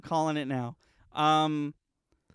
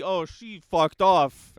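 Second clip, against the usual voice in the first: slow, loud, offhand shrug; casual dismissive; 2 semitones higher, 9 dB louder, much slower than usual. Retold or imitated. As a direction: toss it off loudly and slowly. Mock dialogue of the studio.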